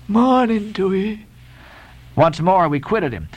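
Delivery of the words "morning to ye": high, slow sing-out farewell; Elijah's voice. A narrator's reading. Elijah parting from the sailors, high and slow. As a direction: stretch out the greeting as an odd stranger.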